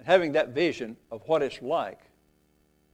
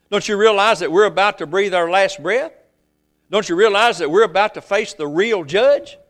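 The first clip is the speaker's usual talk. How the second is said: louder and higher, rising urgent pleas; a dying thief begging his friend to wake up; pleading thief; 5 semitones higher, 8 dB louder than usual. Acted.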